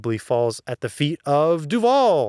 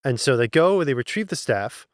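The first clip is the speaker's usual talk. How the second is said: usual speaking voice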